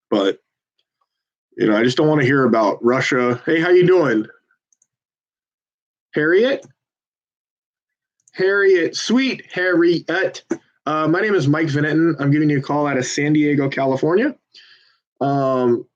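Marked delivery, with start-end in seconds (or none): 3.5-4.3 bright, higher cold-call hello; upbeat telemarketer hello; phone greeting. Real, not acted. 6.1-14.4 stretched sweet Harriet, then the pitch; friendly caller voice. Real, not acted. Phone banker talking to voter.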